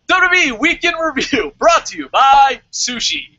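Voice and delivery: loud, high announcer call; mock sponsor announcement. Host pretends sushi sponsors the review, loud announcer voice. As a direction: proclaim a joke sponsor like an announcer.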